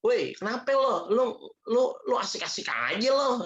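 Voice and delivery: mock a Betawi guy calling out; playful Betawi Jakarta accent; Betawi slang. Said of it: "Kalau Betawi ngomongnya".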